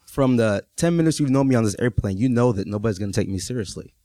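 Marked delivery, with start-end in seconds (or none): none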